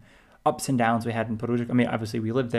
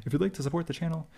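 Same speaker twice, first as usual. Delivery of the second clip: podcast voice; 5 semitones higher, 3 dB quieter, faster than usual. Shown as a demonstration. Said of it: "my, like, podcast voice".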